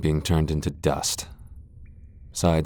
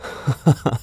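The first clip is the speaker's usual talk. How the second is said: high, airy laugh of contempt; scornful laugh; 8 semitones higher than usual. Acted. Vampire laughing because the hunter won't strike. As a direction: laugh at their hesitation.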